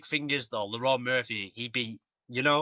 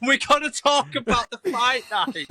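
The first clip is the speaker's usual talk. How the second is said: laughing; 9 semitones higher than usual. Real, not acted. Laughing, high and loud.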